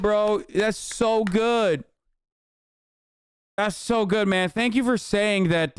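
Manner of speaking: mock-impressed, drawn-out congratulations; sarcastic praise. Mocking a commenter's obvious take, sarcastic praise. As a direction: pour on fake admiration.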